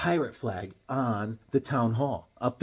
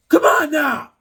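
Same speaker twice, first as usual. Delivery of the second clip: high, loud cry of protest; mock outraged shout; 13 semitones higher, 9 dB louder, slower than usual. Protesting brother caught by the family, high loud cry. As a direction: yell it like you're caught out.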